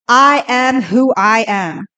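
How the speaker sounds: slow, weighty divine voice; a slow, grave voice of the Lord; voice of God. Acted. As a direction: give God's words slow and full. Quoting God's name slowly.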